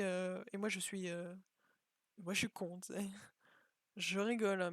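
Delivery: ordinary talk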